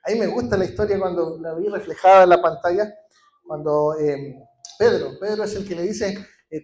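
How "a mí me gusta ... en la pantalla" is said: normal talking voice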